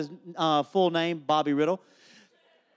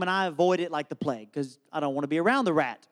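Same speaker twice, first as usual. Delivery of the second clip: ordinary talk, nothing marked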